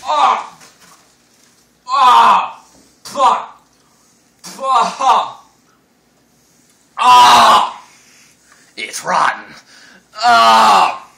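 yelping from the burning taste; pained burning cries. Real, not acted. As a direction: cry out in pain. Eating wasabi, burning mouth.

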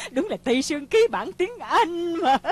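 high, loud, laughing jibe; mock through laughter, voice pitched high; gleeful teasing, breaking into laughter; laughing mockery. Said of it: "làm gì mà cười dữ vậy".

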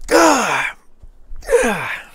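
high, loud cry of frustration; frustrated yell. Real, not acted. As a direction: yell out your exasperation. Frustrated at losing on time.

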